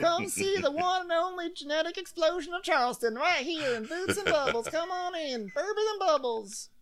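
very high hawker voice; pitched-way-up barker pitch; street barker. Retold or imitated. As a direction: lure passersby inside. Mock street barking.

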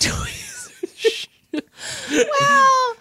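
high squeal through laughter; laughing. Real, not acted. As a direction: burst out laughing on the word. Cracking up at tweezers joke.

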